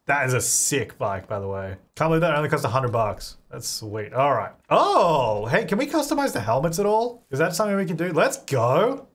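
ordinary talk

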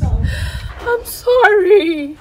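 tearful, drawn-out pleading; crying apology. Real, not acted. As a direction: sob out the apology, begging forgiveness. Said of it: "Why are you crying?".

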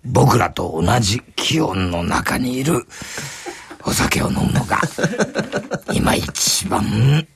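a lightly voiced old man sharing wisdom; old man explaining room-temperature sake to the customers; low sage drinker. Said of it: "あの低く渋い".

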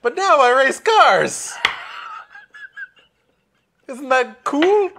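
high, loud, stretched-out yell; over-the-top goofy bragging, breaking into laughter; boastful ballplayer character. Acted. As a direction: holler it slowly, showing off. Voices his character boasting loudly.

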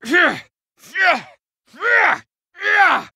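high shouted attack grunts; battle yell. Acted. Fighter landing hits, high shouted yells. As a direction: shout it on the strike.

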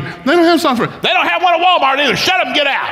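high-pitched mock squabbling; hammed-up family spat; exasperated quarrel. Retold quarrel in the car.

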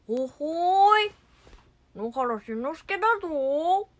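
loud, drawn-out cartoon boy; Crayon Shin-chan. Cartoon boy introducing himself, loud drawling kid voice. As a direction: stretch it slowly, high and loud, as a cheeky kid. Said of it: "クレヨンしんちゃん".

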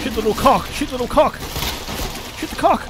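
high, loud panicked repeating; panicked shouting mid-fight; frantic. Real, not acted. Frantic shooting in boss fight.

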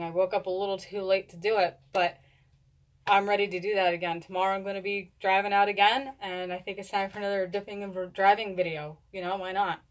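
ordinary delivery

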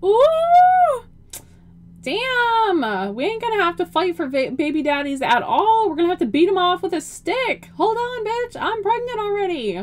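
high, loud excited squealing. Real, not acted. A gamer squealing over a handsome sim, excited. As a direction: gush over the hot guy. Thrilled at handsome sim's looks.

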